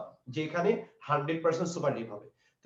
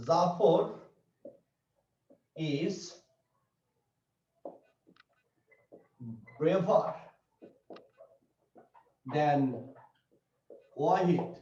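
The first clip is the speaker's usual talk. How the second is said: word-by-word dictation; 2 semitones lower, 4 dB quieter, much slower than usual. Shown as a demonstration. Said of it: "তা সেন্টেন্সটা দেখো".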